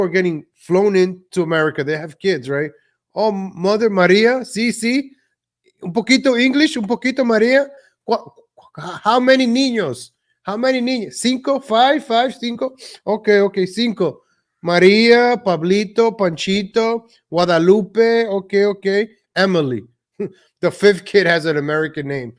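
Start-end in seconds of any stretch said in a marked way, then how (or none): 3.1-11.1 higher, singsong mock Mexican accent. Vlogger mocking an immigrant mother listing her kids, Mexican accent. 11.1-12.0 higher, singsong mock Mexican accent. Vlogger mocking an immigrant mother listing her kids, Mexican accent. 12.0-19.8 higher, singsong mock Mexican accent. Vlogger mocking an immigrant mother listing her kids, Mexican accent.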